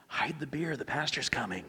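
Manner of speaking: hushed warning. Retold or imitated. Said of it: "it's always like".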